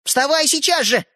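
high, urgent command; insistent boyish cry; shouted order. A narrator's reading. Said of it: "закричал в ответ Светлик".

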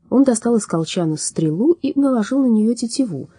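usual speaking voice

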